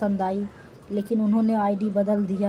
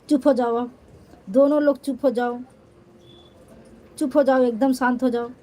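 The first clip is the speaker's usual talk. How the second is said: calling out; 3 semitones higher, 5 dB louder, faster than usual. Real, not acted. Ordering crying viewers quiet.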